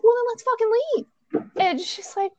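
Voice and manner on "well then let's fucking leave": high, fast, exasperated retort; retold exasperation. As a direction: imitate an impatient push to go.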